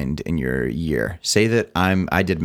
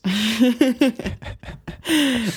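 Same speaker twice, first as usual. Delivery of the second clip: high laugh. Real, not acted. Laughing at joke.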